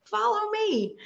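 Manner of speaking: lightly voiced high follow me; mild imitation. Staffer leading Debbie backstage, breezy high call. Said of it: "And she said".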